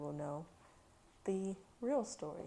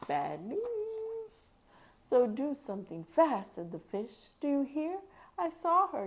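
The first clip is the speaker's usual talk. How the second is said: put-on lively, louder read-aloud voice for kids; story reading aloud; 5 semitones higher, 5 dB louder than usual. A narrator's reading. Mom reading Dr. Seuss to her kids, lively read-aloud. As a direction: read the rhyme to a child with bounce.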